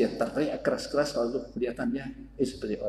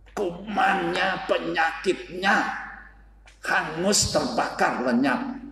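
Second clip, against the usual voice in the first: fiery preaching; 7 semitones higher than usual. Acted. Preacher tells the congregation the devil burns away, preaching hard. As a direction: proclaim the burning power of God.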